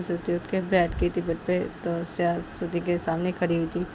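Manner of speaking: plain reading voice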